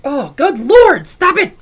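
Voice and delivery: exasperated shout. Real, not acted. Exasperated at game play.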